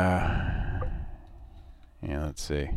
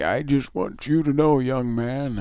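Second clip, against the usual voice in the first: Grandpa voice; 8 semitones higher than usual. Retold or imitated. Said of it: "It's Grandpa saying".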